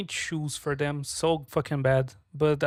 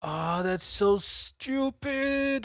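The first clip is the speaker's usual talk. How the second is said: much higher and very slow, stretched-out disgust; exasperated; 9 semitones higher, much slower than usual. Real, not acted. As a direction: drag it out in fed-up disbelief. Realising the cause, exasperated.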